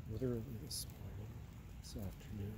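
ordinary talk, nothing marked

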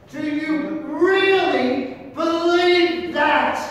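loud, high, slow preaching challenge; fiery preacher voice; preaching at full force. Real, not acted. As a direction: thunder the question at them. Preacher presses the congregation.

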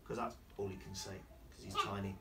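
ordinary delivery, nothing marked

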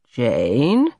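drawn-out name rising in warning; reproving. A narrator's reading. Everard catches Jane lying, reproving. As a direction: call out a fib with one word.

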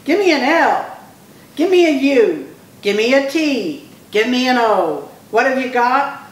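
rally the crowd letter by letter; cheerleader yell. A narrator's reading. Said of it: "Skunk yelled".

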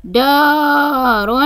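long held aa in دار; دار said slowly with a sustained daa; elongated letter. Shown as a demonstration. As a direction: stretch the ا of دار right out. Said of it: "حرف الدال ممدود بالألف".

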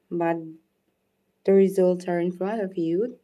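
usual speaking voice

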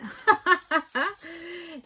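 high burst of real laughter. Real, not acted. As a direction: laugh out at your own excitement. Amused after retelling her excitement.

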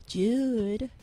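low, breathy come-on voice; sexy voice. Shown as a demonstration. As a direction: say the name like a seduction. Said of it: "change my voice to sexy voice".

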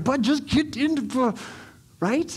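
high, spluttering parental exasperation; exasperated dad. Acted. Dad hurrying his dawdling son into the store, exasperated and spluttering.

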